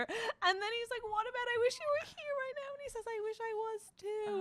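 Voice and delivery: high emotional retelling; tender retelling. Acted. Retelling tender love lines.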